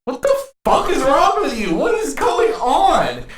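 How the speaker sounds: high, incredulous outburst with a laugh; mock shocked protest at the stranger; disbelief. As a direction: squeak out total disbelief. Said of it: "and I'm like".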